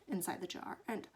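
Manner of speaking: usual speaking voice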